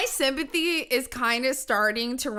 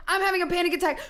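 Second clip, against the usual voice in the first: higher, hammed-up panicked cry; mock panic; 7 semitones higher, much faster than usual. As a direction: imitate someone claiming panic. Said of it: "They're going from".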